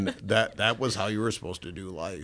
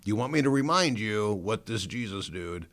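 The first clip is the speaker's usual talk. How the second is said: loud, exasperated retelling of a son's protest; exasperated retort; 4 semitones higher, 6 dB louder than usual. Retold or imitated. Son cutting off mom's talk about Jesus, loudly exasperated. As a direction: push back hard, fed up. Retold outburst at preaching mom.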